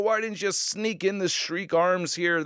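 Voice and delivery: ordinary talk, nothing marked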